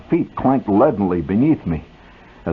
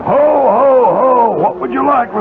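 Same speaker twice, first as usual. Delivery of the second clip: jolly Santa; 12 semitones higher, 4 dB louder, much slower than usual. Acted. Santa pushes a football.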